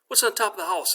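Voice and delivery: high-pitched prompting question; acted quiz question, pitched well up; dog owner quizzing. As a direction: coax the answer from your dog.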